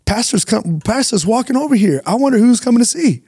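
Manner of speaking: nervous inner panic. Retold or imitated. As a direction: act out the jolt of seeing the boss approach. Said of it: "and I'm like".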